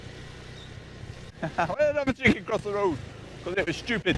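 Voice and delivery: high, loud, slow put-on joke voice; silly joke voice. Telling the camera a daft joke, hammed up. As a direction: hams up a daft chicken joke.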